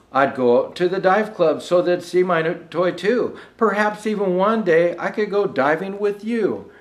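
measured, rhythmic storybook reading; lilting holiday verse reading; poem recitation. Acted. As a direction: read it like a bedtime Christmas rhyme. Rhymed Christmas poem read aloud.

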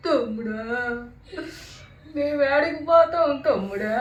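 slow, drawn-out wail to a brother; sing-song mourning lament, hammed up; mock funeral wail. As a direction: imitate crying at a funeral, stretching each call. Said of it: "నేను చెప్పనా ఏం ఏడ్చాను అంటే".

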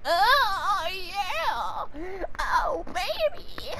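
very high, drawn-out moaning granny; old grandma. Pretend. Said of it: "I sound like an old grandma".

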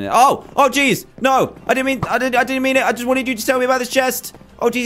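high, startled, pleading panic; panicked yelp. Real, not acted. Caught off guard by the angry captain, high panicked pleading. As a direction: blurt it out in a high-pitched fright. Captain suddenly turns hostile.

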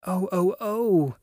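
high, drawn-out wail of dismay; distressed cry. A narrator's reading. Two girls rushing to the broken table, distressed cry. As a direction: cry out slowly in dismay, voice lifted. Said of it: "cried the two girls".

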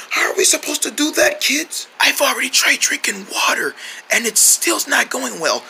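weak whispery voice; lost voice. Acted. King asking the kids for a cure, weak whisper. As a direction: complain through a failing voice. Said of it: "the king lost his voice".

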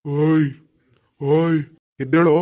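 calling out loudly to wake him. Acted. Ghost wakes sleeping husband. (ಜೋರಾಗಿ ಕೂಗಿ ಎಬ್ಬಿಸುವುದು)

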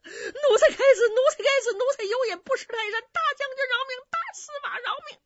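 high, loud wailing plea, speeding up at the end; desperate begging. Acted. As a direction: grovel and beg, voice cracking. 自打嘴巴求饶. (尖声哀求饶命)

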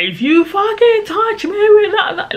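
high-pitched reenacted screamed threat; retold scream. Storyteller replaying her warning to a knife-waving roommate, mock scream. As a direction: screech it out as a warning, retelling the fight. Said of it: "like, I was screaming at her".

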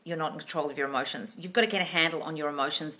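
ordinary talk

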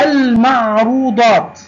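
المعروضات slowly with a clear l; moon lam. Shown as a demonstration. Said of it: "لام قمرية". (نطق «المعروضات» ببطء بلام ساكنة واضحة)

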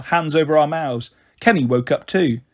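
usual speaking voice

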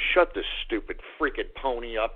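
usual speaking voice

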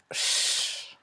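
a long hushing ch; isolated speech sound. Shown as a demonstration. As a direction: hold ch as a steady shhh. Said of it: "on avait vu le son". (le son ch prolongé, chhh)

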